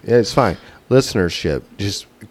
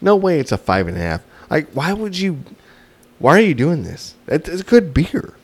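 high-pitched, disbelieving objection; incredulous protest; 6 semitones higher than usual. Real, not acted. Co-host disputing a five and a half, incredulous protest. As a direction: argue it in disbelief, voice climbing high. Arguing against co-host's low score.

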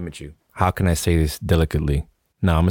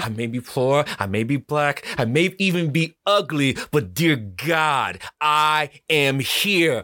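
heightened affirmation; 6 semitones higher, slower than usual. Shown as a demonstration. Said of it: "Say it again".

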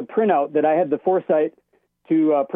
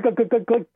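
quick higher clicks, mimicking typing; imitated keyboard clicks; 5 semitones higher than usual. Caller mimics the judge typing in the silent courtroom, light clicking sounds. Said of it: "And then click".

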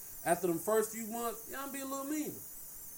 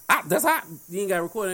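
normal talking voice

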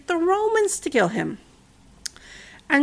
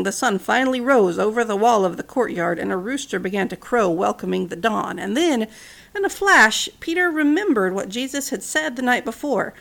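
ordinary delivery

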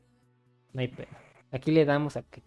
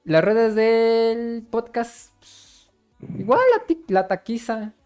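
announcer; 9 semitones higher, 6 dB louder, much slower than usual. Acted. Slowed announcer-style plug.

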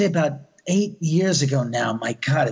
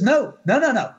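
insistent no; 7 semitones higher than usual. Retold or imitated. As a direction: insist like a scared friend. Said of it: "And she goes".

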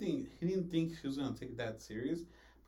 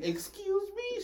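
very high, drawn-out mock indignation; offended outrage; much slower than usual. Old lady answering the manager's joke, hammed-up offense. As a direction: imitate an affronted old lady, voice shooting up. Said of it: "She got angry".

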